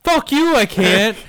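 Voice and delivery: high, loud comeback; playfully outraged retort; mock indignant. Real, not acted. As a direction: throw it back loudly at a doubter. Snaps back when doubted.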